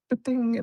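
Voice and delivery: high, sharp ba-ding; ping it like a round cracking past; a quick, high mock ricochet sound; imitated bullet ping.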